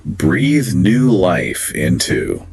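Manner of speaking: "breathe new life into" said very slowly, every word spaced out; slow, deliberate model phrase. Shown as a demonstration. Teacher modelling the phrase for learners, slow and deliberate. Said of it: "one of the most evocative phrases".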